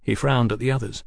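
ordinary delivery, nothing marked

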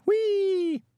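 put-on very high, loud child's squeal; high-pitched playground glee; gleeful whee. A narrator's reading. Child enjoying the ducking stool.

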